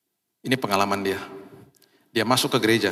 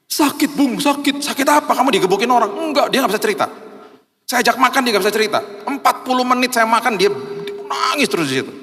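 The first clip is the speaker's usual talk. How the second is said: agitated retelling; 11 semitones higher than usual. Retold or imitated. Preacher recounting the weeping friend's pain, high and urgent. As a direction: rush it out, heated and pitched up.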